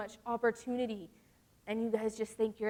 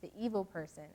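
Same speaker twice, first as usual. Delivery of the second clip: scripture reading; 4 dB louder than usual. Acted. Reads scripture aloud.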